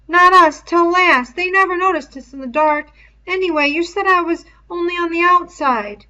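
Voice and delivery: put-on high, loud boyish voice, urgent; Piggy's high pleading voice. A narrator's reading. Piggy argues they were outside.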